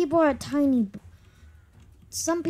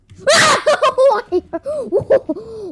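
loud laughter; 10 semitones higher, 8 dB louder than usual. Real, not acted. Bursts out laughing.

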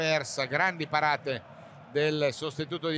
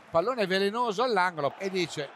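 ordinary talk, nothing marked

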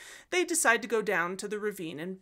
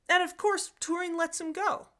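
mock dramatic; 9 semitones higher than usual. Shown as a demonstration. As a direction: set up a joke twist. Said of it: "I'm just kidding".

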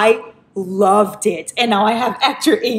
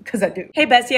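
ordinary delivery

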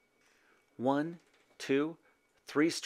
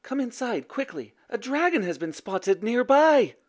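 high, anxious warning; urgent mother; 10 semitones higher than usual. A narrator's reading. Mother warning son of a dragon, urgent. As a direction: usher someone in with alarm in the voice.

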